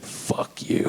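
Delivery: unvoiced, flat fuck-off; whispered retort; waitress whisper. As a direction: breathe the insult like a fed-up waitress. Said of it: "she just was like".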